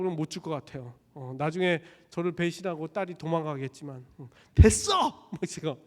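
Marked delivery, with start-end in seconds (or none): 4.5-5.3 high, loud snapped refusal; angry shout. Acted. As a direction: slam the door on it. Daughter refusing father.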